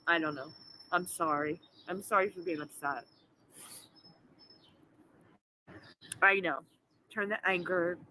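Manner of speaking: low, soft, choked-up apologies after crying; holding back tears while apologizing; tearful apology. Real, not acted. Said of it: "I'm gonna stop fucking crying".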